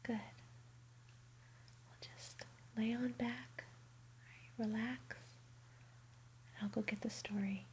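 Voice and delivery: ordinary talk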